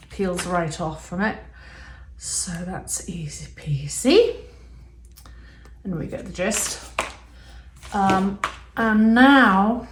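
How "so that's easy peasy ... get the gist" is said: whispering. Real, not acted. Whispered playful aside.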